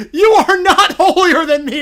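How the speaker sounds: loud, high indignant claim; laughing mock shout. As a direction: shout it while cracking up. Voicing Caillou, laughing, loud.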